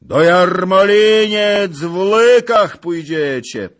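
high, very loud drawn bellow; furious threat. A narrator's reading. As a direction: roar out the threat. Zagłoba threatens the wedding party. (groźny ryk)

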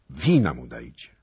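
loud call on the first word. A narrator's reading. A voice in the hall orders wine for the stranger, calling out.

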